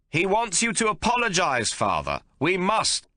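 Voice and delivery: loud, high pleading into an ear; embarrassed son pressing his father; urging son. A narrator's reading. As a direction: lean close and urge.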